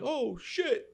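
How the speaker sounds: high, loud Brooklyn yelp of shock; thick Brooklyn accent. Retold or imitated. Train driver hears kid got robbed, shocked Brooklyn accent. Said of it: "thick Brooklyn accent".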